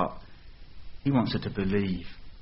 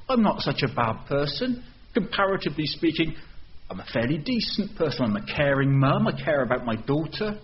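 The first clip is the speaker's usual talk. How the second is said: higher, indignant rant at Jesus; furiously offended; 5 semitones higher than usual. Acted. Offended mother protesting to Jesus, indignant and rising. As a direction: bristle with wounded pride, then build to outrage. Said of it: "She's going to be furiously offended".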